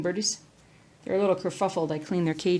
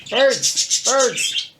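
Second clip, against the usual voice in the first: loud call to the birds; 6 semitones higher, 8 dB louder than usual. Real, not acted. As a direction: call the birds across the room. Calling out to birds.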